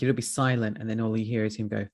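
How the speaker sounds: ordinary talk, nothing marked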